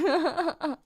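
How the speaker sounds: real giggles breaking out after the word; already laughing. Real, not acted. Streamer about to play the clip, already laughing. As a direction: try to start, then crack up.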